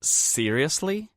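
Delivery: high, slow, drawn-out scoff; scornful disbelief. A narrator's reading. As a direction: drag it out mockingly. Amanda mocks Tate.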